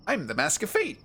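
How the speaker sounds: rising, questioning lilt on the title; I'm the Mask of Fate, ending in an unintended upward lift; upward inflection. Acted. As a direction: let FATE float up high like a question. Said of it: "I put such an upward inflection on that".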